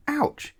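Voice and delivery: high, sharp ouch; sudden pained yelp. A narrator's reading. Miss Neat sitting on the hidden teaspoons, a high sharp yelp. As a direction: yelp as if stabbed by a spoon.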